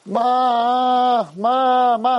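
high, drawn-out mock wail; acted high call for mother; imitated dying scream. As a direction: cry out like a hammed-up war-picture actor. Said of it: "they would be screaming".

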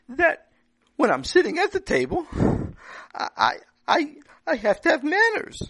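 put-on child voice explaining himself; little boy. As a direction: play a young boy insisting he did nothing wrong. Boy argues with his father.

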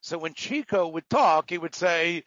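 usual speaking voice

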